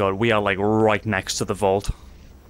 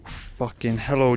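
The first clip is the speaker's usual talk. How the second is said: ordinary delivery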